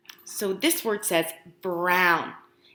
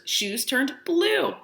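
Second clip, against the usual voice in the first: plain reading voice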